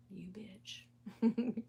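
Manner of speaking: unvoiced teasing whisper. Husband teasing her, a soft whisper. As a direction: whisper the insult playfully. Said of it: "And he was like".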